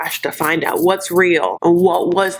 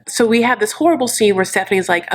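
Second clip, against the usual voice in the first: ordinary delivery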